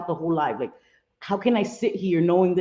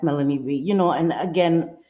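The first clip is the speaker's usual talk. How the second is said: ordinary delivery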